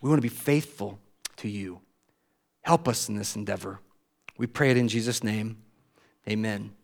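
soft, low closing prayer. Real, not acted. Pastor closing prayer to God, soft and low. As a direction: pray it quietly over the church.

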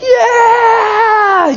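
long, high-pitched cheer; crowd scream. Acted. People lining the road as Jesus comes, screaming cheer. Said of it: "they start to scream toward Jesus".

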